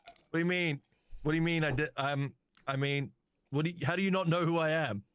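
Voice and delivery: offended robot voice. Acted. Thoven voiced by GM.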